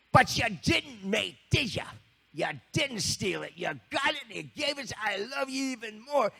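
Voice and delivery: high, loud English exclamation. Retold or imitated. [name] forgiving the confessing kid, high loud English voice. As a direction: burst out with affection. [name] grabs him again.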